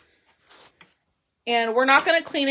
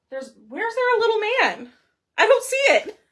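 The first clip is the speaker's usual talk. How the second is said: puzzled disbelief; 12 semitones higher, 5 dB louder than usual. Real, not acted. Searching for the little man.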